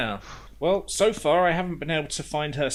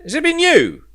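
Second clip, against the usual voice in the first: higher and louder, hammed-up indignant yelling; mock outrage; 9 semitones higher, 10 dB louder than usual. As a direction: play up the fake fury at the coffee thief. Mock outrage over carbonated coffee.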